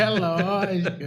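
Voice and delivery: laughs loudly, then agrees; knowing laugh at the innuendo; laughing agreement. Real, not acted. Cohost laughing at the doctor's innuendo about bedtime. Host hints at night activities.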